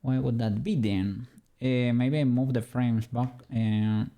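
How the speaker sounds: normal talking voice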